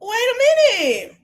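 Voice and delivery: high, loud, slow wait a minute; stunned wait. Real, not acted. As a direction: drag it out in shock. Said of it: "reacting to the fight".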